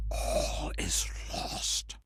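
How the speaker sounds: slow breathy whisper of doom; despairing whisper. Acted. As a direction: let it die in a whisper. Fading into a whisper.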